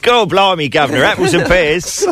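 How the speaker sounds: high, hammed-up Cockney accent; mock Cockney accent.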